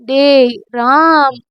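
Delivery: slow, drawn-out tipsy call; slurred drunk call; drunk drawl. Acted. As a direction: drag the name out like she's drunk. Drunk girl calls him again.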